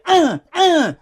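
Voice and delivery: short, high-pitched effort noises; mock whimpering grunts. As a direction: imitate a worked-up little fighter. Imitating the partner ready to kick.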